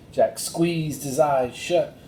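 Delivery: ordinary delivery, nothing marked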